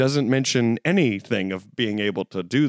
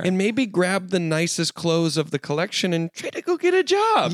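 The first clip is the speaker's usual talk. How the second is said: hammed-up spouse pleading for a job; mock nagging partner; 7 semitones higher than usual. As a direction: pitch climbing to an exasperated squeak. Acts nagging partner, climbing pitch.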